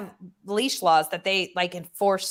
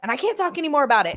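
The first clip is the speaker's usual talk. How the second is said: high, loud, brash phone voice; loud screaming; 10 semitones higher, 5 dB louder than usual. Retold or imitated. New project manager shouting down the phone. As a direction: bark the news at them like a loud boss. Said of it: "I mean, screaming".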